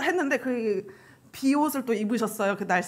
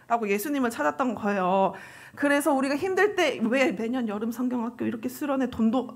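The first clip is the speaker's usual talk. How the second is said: normal talking voice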